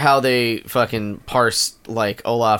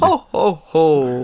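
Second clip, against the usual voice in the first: high, merry ho ho ho; Santa laugh; 3 semitones higher, much slower than usual. Pretend. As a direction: play Santa jolly.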